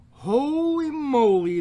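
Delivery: loud, high, slow amazed cry; astonished exclamation. Real, not acted. Stunned by the wall of card text, loud and drawn out. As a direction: gasp out the amazement.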